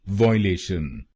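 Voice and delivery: वायलेशन said as "voileshan", first syllable as one "voy"; wrong Indian pronunciation. Shown as a demonstration. Teacher demonstrating the common Indian mistake, slow wrong pronunciation. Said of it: "कुछ लोग भारत में इस शब्द को गलत बोलते हैं".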